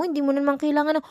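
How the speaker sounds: ordinary delivery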